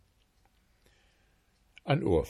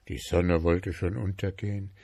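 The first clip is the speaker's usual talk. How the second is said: usual speaking voice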